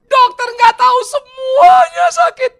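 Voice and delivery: slow, very high full-voiced shout; hammed-up anguished patient at full volume; yelling in pain. Patient insisting to the doctor everything hurts, yelling in pain. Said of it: "dia teriak-teriak sakit".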